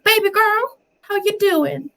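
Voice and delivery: very high-pitched flirty Southern belle. Retold or imitated. Niece imitating her flirting aunt, high Southern belle. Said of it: "she turns into a Southern belle".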